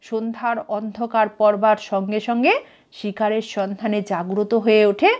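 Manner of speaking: usual speaking voice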